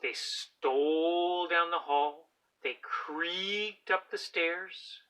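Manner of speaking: slow, suspenseful sneaking read; tiptoeing animals read slowly; slow creeping narration. A narrator's reading. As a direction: creep through the words slowly.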